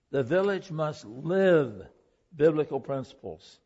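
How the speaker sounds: slow, with LIVE stressed; emphasized line. Acted. Preacher drives home his main point to the congregation, slow and stressed. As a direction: hit "live", then bring "biblical principles" down firmly. Preacher repeats the key principle.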